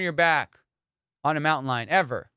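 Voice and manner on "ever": normal talking voice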